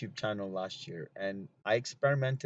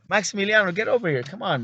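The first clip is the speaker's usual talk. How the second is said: calling the dog; 7 semitones higher, 9 dB louder, faster than usual. Retold or imitated.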